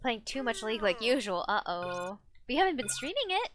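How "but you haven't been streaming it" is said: playful protest. Real, not acted. Teasing the chat back.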